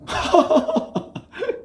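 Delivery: high, airy laugh. Real, not acted. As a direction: burst out in a high, voiceless laugh. Preacher laughing at his own point.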